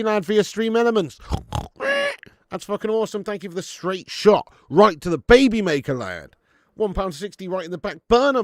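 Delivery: loud, high, pumped-up thank-you to a tipper; hyped donation shout-out. Acted. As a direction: shout the tip out like a hype man.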